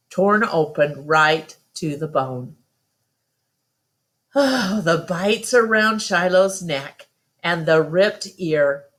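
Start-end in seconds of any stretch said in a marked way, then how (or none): none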